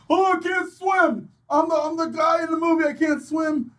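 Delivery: high panicky whine, half-joking; mock the helpless guy in a high voice; scared whiny voice. Mocking the scared character.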